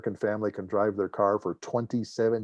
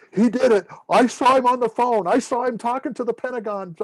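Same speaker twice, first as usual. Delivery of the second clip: higher and louder, pointing the finger; gleeful mock accusation, raised voice; tattling buddies; 13 semitones higher, 8 dB louder than usual. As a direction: squad mates ratting out a friend, loud and high. Buddies rat him out.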